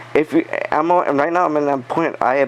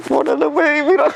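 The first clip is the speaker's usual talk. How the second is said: much higher, squeaky boss voice; startled boss; 3 dB louder than usual. Retold or imitated. Boss watching from the corner, high startled squeak. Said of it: "my boss is in the corner like".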